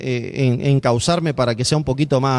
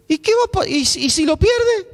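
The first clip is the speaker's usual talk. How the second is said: worried parent; slower than usual. Retold or imitated. Parent worries about losing baby.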